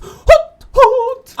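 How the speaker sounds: high loud owl hoot. Acted. Player voicing the owl character, high hooting.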